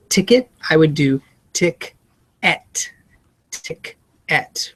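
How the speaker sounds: "tick, et" said in two clear parts; split into syllables. Shown as a demonstration. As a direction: break "tick" and "et" apart, stress the E. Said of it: "Really enunciate that so they hear it's an E".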